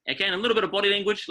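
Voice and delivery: normal talking voice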